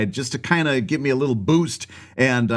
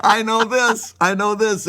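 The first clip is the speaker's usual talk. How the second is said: insistent; 9 semitones higher, 3 dB louder than usual. Real, not acted. Insisting while laughed at.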